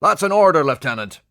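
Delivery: loud, high barked order; commanding shout. Acted. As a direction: give the order with force.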